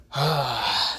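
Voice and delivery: long, audible acted sigh; heavy sigh of annoyance; exasperated sigh. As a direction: let out a weary breath. Aku exasperated, sighs before complaining.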